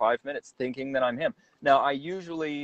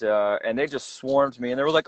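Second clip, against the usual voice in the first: ordinary talk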